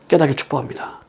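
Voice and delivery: ordinary delivery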